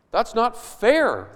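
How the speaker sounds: slow, loud, high complaint of unfairness; hammed-up grumble of the hired hands; mock whine. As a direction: drag it out like a sulky worker. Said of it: "they say".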